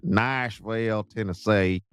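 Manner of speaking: slow, drawn-out Southern accent; thick, slow Southern twang. Shown as a demonstration. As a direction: stretch it into a Southern drawl. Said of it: "say it in a Southern accent".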